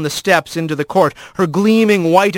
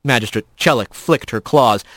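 plain reading voice

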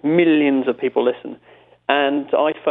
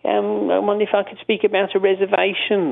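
Robin Cooper voice; 7 semitones higher than usual. Retold or imitated. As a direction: sound genteel and overly polite. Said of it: "he had a certain voice".

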